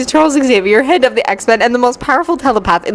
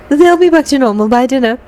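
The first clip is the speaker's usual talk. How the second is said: lightly voiced higher-pitched woman; a touch higher, confidently reassuring; reassuring witch; 4 semitones higher than usual. A narrator's reading. Weather Witch voiced, reassuring Charles.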